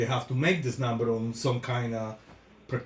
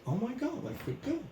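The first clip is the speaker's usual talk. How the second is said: imitating a patient; 9 semitones higher, 8 dB quieter, faster than usual. Voicing a happy placebo patient.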